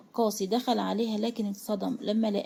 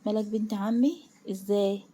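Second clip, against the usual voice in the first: plain reading voice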